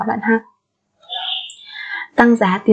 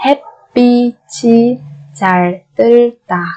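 syllable by syllable. Shown as a demonstration. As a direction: sound out 햇빛이 잘 들다 piece by piece. Slow syllable-by-syllable model. (đọc 햇빛이 잘 들다 chậm từng âm tiết)

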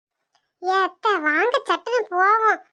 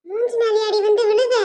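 ordinary talk, nothing marked

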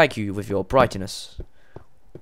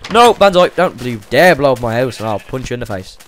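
loud shouted threat at the creeper; panicked yell; 11 dB louder than usual. Real, not acted. Gamer yelling at a creeper by his house, loud and panicked. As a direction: yell to stop it blowing up the house.

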